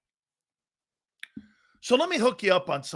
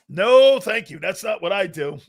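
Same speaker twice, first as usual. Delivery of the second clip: usual speaking voice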